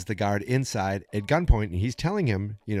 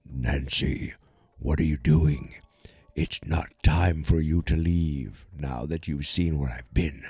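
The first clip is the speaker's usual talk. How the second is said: low, slow menacing villain voice; a deep, looming monster speaking calmly; lower, slow and menacing; Vecna; 5 semitones lower, slower than usual. Retold or imitated. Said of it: "And Vecna's like".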